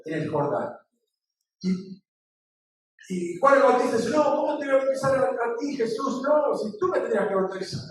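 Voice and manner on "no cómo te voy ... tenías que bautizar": high-pitched, reluctant objection; imitating a startled protest; mild retold protest. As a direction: act out a humble refusal, lightly. Voices John protesting to Jesus.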